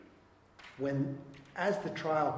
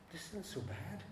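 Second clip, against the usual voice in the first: relieved murmur; 3 semitones higher, 12 dB quieter than usual. Retold or imitated. Relief at hitting the bottom.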